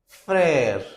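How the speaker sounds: "frère" loud, with a long open "eh"; prolonged E. Shown as a demonstration. Teacher gives French for brother, "frère" slowly stretched. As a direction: draw out the vowel of "frère". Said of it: "It prolongs the pronunciation".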